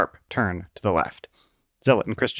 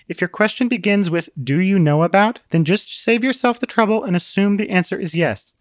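condescending; 8 semitones higher than usual. A narrator's reading. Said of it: "Truth gave a condescending glance".

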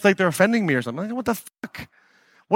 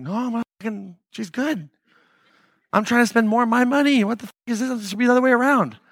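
exasperated defending; 7 semitones higher than usual. Retold or imitated. Host telling wife Jenna he wants to pay more, high exasperation. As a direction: defend yourself to your wife, indignant. Answering his wife about overpaying.

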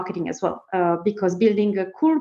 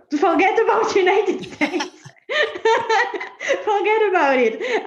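higher, laughing forget about it; laughing dismissal; 10 semitones higher than usual. Real, not acted. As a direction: laugh it off, high.

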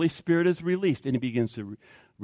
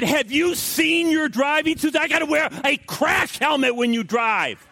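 high, exasperated yelling; hammed-up angry outburst; uncontrolled temper; 10 semitones higher than usual. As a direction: rant at your wife over her driving. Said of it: "if the Holy Spirit is not controlling Frank".

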